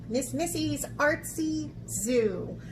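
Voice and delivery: usual speaking voice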